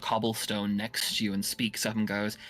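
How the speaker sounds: ordinary talk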